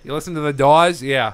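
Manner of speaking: mock Boston accent question. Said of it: "That was my horrible Boston accent".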